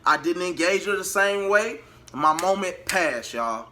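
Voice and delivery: ordinary delivery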